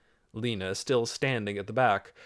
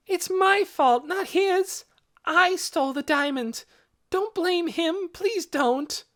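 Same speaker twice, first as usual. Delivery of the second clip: put-on very high child's voice; pleading cry; 4 dB louder than usual. A narrator's reading. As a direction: beg desperately to take the blame. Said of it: "another voice rang out".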